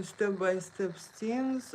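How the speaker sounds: ordinary talk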